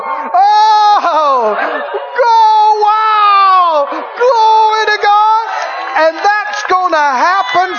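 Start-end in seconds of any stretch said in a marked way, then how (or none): 0.0-5.5 high, drawn-out cries of amazement; awestruck exclamations. Acted. Preacher acting out everyone's amazement, crying out high in awe. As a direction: cry out in overwhelmed, exaggerated wonder. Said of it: "and we'll all go".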